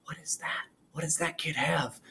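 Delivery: put-on hushed, curious kid whisper. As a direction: whisper it like an amazed classmate. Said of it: "Everybody's gonna say".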